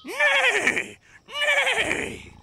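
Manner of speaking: very high whinnying neigh; whinny like a horse. A narrator's reading. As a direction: horses calling to the farmer. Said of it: "said the horses".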